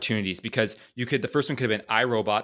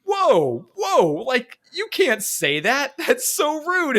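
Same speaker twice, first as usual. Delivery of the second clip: high, slow, scandalised whine; offended Legolas; 13 semitones higher, much slower than usual. Pretend. Legolas scolding Aragorn, high offended whine. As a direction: act deeply offended at the slur. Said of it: "and he goes".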